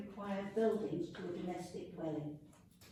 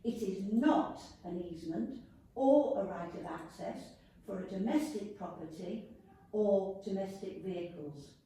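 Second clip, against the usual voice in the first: ordinary talk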